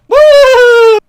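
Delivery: a very high, very loud mock whoop; mock cheer. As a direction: whoop like a fake-hyped host. Mock cheer after quoted question.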